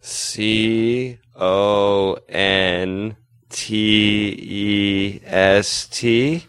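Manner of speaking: C O N T E S T spelled letter by letter, very slowly; spelling it out. Shown as a demonstration. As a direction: spell contest one letter at a time, slowly. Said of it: "Spell contest".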